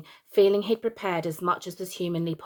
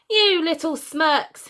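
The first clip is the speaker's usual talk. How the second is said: slow, higher, louder snarl; angry yell; 9 semitones higher, 6 dB louder, slower than usual. Acted. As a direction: spit the insult out slowly. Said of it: "he yelled".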